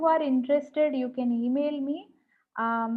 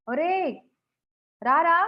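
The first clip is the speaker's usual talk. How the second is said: put-on calling a child; 3 semitones higher, 4 dB louder, much faster than usual. Shown as a demonstration. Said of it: "ఎలా పిలుస్తాం చిన్నపిల్లల్ని".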